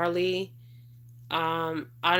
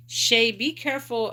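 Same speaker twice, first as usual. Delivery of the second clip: raised, higher call of a viewer's name; urgent warning; 5 semitones higher, 5 dB louder than usual. Real, not acted.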